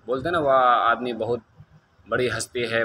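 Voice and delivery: usual speaking voice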